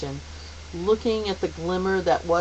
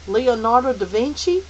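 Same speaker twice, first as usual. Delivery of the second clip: raised, questioning suggestion; hesitant boy's question; tentative; 6 semitones higher, 5 dB louder than usual. Acted. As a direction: offer it as an unsure guess. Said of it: "Calvin suggested tentatively".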